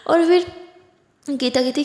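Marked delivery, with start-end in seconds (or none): none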